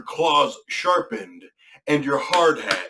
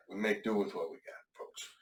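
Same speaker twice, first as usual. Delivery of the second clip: softer, mumbled repeat; quieter, trailing off; 3 semitones lower, 11 dB quieter than usual. Real, not acted. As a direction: mutter it, fading away. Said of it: "can't hear me through the mask".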